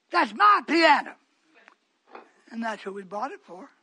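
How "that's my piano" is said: louder, slow, indignant cry; wife's loud protest. Retold or imitated. Preacher imitating wife claiming her piano, loud. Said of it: "She would come running in here and say".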